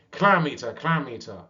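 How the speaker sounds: loud high taunt that eases on the repeat; taunting shout. Acted. Young seal jeering at the old walrus, loud. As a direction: jeer it at the top of your voice. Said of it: "shouted as loud as he could".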